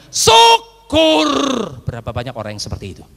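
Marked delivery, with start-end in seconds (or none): 0.1-1.3 loud, high, drawn-out jeer; mocking "serves you right". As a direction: shout the taunt in mock contempt. Ejekan yang diulang lebih keras. (ejekan lantang pura-pura, nada tinggi)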